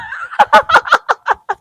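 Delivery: a burst of high teasing laughter. Real, not acted. Teasing the shy boy.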